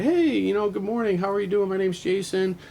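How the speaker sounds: high, bright friendly greeting; friendly phone greeting. Retold or imitated. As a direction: greet her brightly and cheerfully. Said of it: "and I was like".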